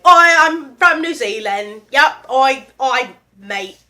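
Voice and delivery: loud, hammed-up New Zealand accent; badly faked New Zealand greeting, drawn out. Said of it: "do a New Zealand accent".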